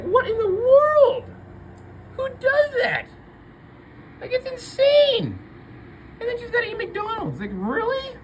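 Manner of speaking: way up high in disbelief; outraged falsetto. Real, not acted. Ranting to viewers about the tow crew, high and incredulous. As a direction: squeaks out the outrage.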